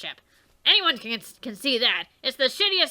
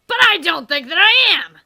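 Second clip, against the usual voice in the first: much louder, higher indignant shout; loud protest; 5 semitones higher, 10 dB louder than usual. Acted. Character shouts back at Dave, indignant. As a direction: throw the denial back hard.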